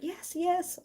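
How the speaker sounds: high, thin squeaky voice. Retold or imitated. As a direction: squeak it out timidly. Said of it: "He had a little squeaky voice like".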